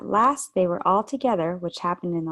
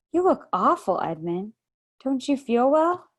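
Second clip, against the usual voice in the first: put-on higher, louder worried girl voice; concerned girl; 6 semitones higher, 4 dB louder than usual. A narrator's reading. Lucy noticing her sick brother, concerned girl. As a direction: fuss over him.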